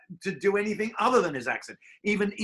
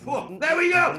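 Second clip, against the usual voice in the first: excited whoop; 9 semitones higher, 6 dB louder than usual. Real, not acted. Excited reaction after performance.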